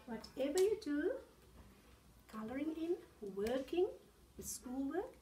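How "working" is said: plain reading voice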